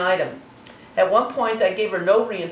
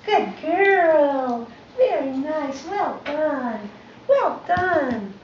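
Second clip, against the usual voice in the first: sing-song praise pitched well above normal; high, cooing dog-praise voice; 8 semitones higher, much slower than usual. Real, not acted. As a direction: gush over the dog, high and bright. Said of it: "how I used my tone of voice".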